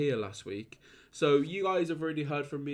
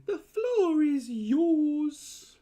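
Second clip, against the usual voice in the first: hammed-up announcer; 13 semitones higher, 6 dB louder, much slower than usual. Hamming up handing over.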